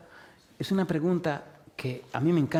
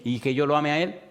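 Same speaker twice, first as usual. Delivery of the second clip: preaching at full force; 3 semitones higher than usual. Acted. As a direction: drive each yes-or-no question home to the flock. Preacher drills the congregation.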